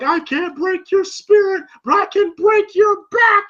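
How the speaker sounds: high-pitched, hammed-up taunt; mock wrestler threat. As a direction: declaim it like a showboating wrestler. Imitating wrestler threatening Rocky.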